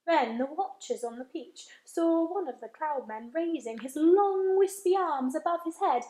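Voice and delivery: plain reading voice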